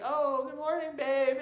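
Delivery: high, loud sweet wife voice; imitating his wife. Mocking his wife's sweet greeting after the headbutt, high and loud. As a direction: put on her sugary morning greeting. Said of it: "she'd be like".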